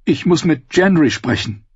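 mildly gasped urgent plea; gasping. Acted. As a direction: push it out, short of breath. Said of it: "keuchte sie".

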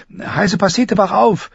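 high-pitched alarmed calling; startled girl calling out; urgent wake-up call. A narrator's reading. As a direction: rouse a sleeping friend urgently. Waking a friend in alarm.

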